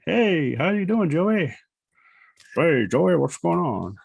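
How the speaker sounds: playful voice for a cartoon puppet saying hi; puppet greeting. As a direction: greet a pal as the animated character, lilting. Voicing the animated puppet's greeting.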